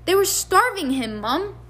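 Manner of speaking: high, pleading protest; protesting teen boy. Acted. George protesting.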